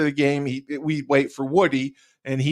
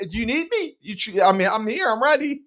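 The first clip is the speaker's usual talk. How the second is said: high, mock-eager volunteering; teasing; 9 semitones higher than usual. Player pestering Kyle for an interview, high mock-eager. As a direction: pester him, pretending to be keen. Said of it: "That's what I would do to him".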